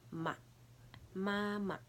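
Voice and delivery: short light staccato "ma". Shown as a demonstration. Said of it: "and then the staccato sound".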